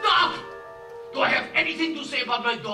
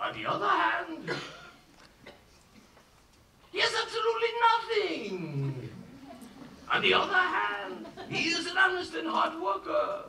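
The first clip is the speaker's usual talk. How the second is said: high, musing debate with himself; weighing aloud; 5 semitones higher, 5 dB quieter than usual. Acted. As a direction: argue both sides to yourself, pitched up. Father weighs the match aloud.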